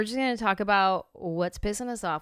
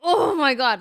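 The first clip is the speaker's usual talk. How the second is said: louder, higher fed-up "oh my God"; exasperated; 6 semitones higher, 8 dB louder than usual. Retold or imitated. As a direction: blow up over a tiny thing. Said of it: "and you're like".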